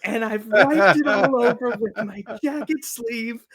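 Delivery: high, loud imitation of a panicked scream, slow and laughing; mock scream. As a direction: squeal it like the panicking wife. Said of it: "she's like".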